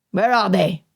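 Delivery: a curt, impatient demand; brusque. A narrator's reading. A stranger outside the cave demanding answers, brusque. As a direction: bark the question short and hard. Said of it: "The tone was brusque".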